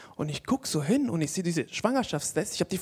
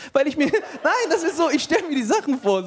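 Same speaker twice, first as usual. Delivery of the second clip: laughing; 9 semitones higher, 4 dB louder than usual. Real, not acted. Laughs at own thought.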